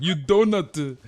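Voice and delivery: loud, high mock chef insult; high, loud Ramsay rant; Gordon Ramsay impression. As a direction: bark it like an angry TV chef. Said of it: "Trainer's like".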